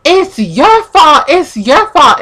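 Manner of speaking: high, loud retold blame, repeated hard; accusing yell. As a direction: imitate him yelling the blame, drawing it out. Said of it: "he's, like, screaming and yelling".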